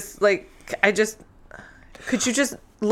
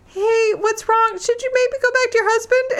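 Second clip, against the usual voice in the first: mock concerned Faith; 13 semitones higher than usual. Mocking Faith asking the heroine, high fake concern. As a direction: play the worried friend way up high.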